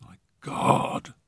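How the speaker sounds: voiceless, soft groan. A narrator's reading. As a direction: groan it out on the breath alone. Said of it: "and groaned".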